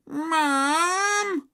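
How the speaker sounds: long, high, loud wail; scream for Mum. A narrator's reading. Peter tells on Henry, screaming. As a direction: scream for a parent. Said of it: "screamed Peter".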